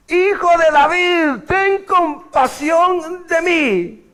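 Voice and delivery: high, slow pleading shout; desperate loud cry. Retold or imitated. Blind Bartimaeus begging Jesus above the crowd, high slow pleading shout. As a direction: cry out over the crowd for mercy. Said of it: "él gritó aún más fuerte".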